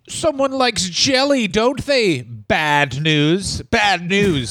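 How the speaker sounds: mocking taunt, starting high; high-pitched goading that drops onto the name; Vince Junior. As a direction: tease him in a put-on boss voice. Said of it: "Vince Junior said".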